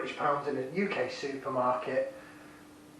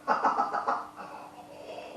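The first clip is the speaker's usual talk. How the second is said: soft, unvoiced chuckle after a sip; hushed delighted laugh; quiet laugh; 3 dB quieter than usual. Real, not acted. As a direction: laugh under your breath at how good it is.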